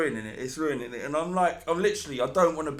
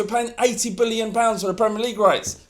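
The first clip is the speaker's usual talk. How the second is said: mock TV advert; 7 semitones higher, 9 dB louder than usual. Mocking Sky Sports promo.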